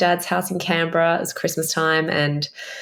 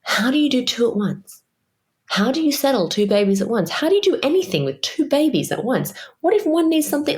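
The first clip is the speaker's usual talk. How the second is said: panicked questions; 7 semitones higher than usual. Retold or imitated. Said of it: "But I was like".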